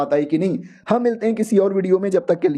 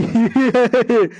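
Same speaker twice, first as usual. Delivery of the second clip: loud mocking laugh in a put-on voice; a menacing laugh before the beating; gloating laugh; 3 semitones higher, 4 dB louder than usual. Senior woman laughing at the loser, mock menacing.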